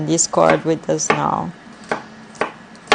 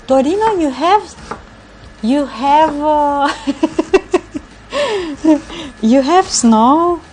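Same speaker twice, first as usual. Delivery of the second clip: surprised; 7 semitones higher, 3 dB louder than usual. Real, not acted. Asks streamer about snow.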